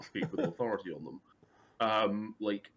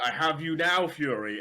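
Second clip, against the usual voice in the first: loud gloating villain line, higher; 5 semitones higher, 6 dB louder, slower than usual. Retold or imitated. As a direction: gloat over the enemy. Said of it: "Red Skull being like".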